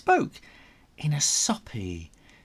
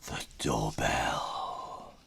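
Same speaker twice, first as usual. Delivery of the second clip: hushed whisper, lower; whispered suspense; 13 semitones lower, 8 dB quieter, slower than usual. A narrator's reading. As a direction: drop to a breathy hush.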